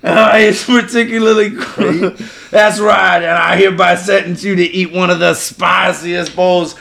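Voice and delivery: put-on a bayou judge scolding a Yankee, thick accent; thick Cajun accent. Judge scolding the defendant, thick Cajun accent. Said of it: "the judge are both hard accents".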